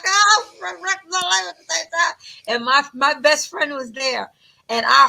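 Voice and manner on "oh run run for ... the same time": mock panic. Mock panic fleeing the cage brawl.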